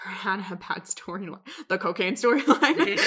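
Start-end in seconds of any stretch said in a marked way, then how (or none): 1.7-3.1 laughs through the words; giggling out the phrase, amused; laughing. Real, not acted. Laughing at a bad plotline.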